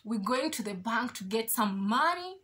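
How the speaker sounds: usual speaking voice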